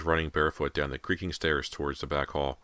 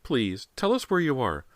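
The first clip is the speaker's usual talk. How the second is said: put-on scared child; 12 semitones higher, 3 dB louder than usual. A narrator's reading. Child voice pleading.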